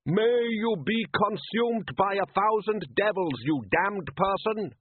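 put-on high, slow, sing-song Klatchian accent; foreign fisherman. Rival fisherman cursing Solid from his boat, sing-song Klatchian accent. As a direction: curse him melodiously across the water. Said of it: "A voice sang over the water".

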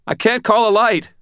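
loud, very high cry; high, thin voice. A narrator's reading. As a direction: call it out high and thin, in alarm. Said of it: "my voice high and thin".

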